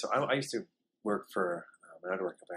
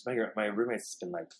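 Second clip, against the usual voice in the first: ordinary talk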